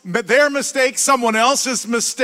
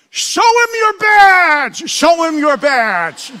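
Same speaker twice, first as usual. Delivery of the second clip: slow, high and loud shout; yelling; 7 semitones higher, 8 dB louder, much slower than usual. Retold or imitated. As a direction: bellow it across the field. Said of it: "to the fence and yells".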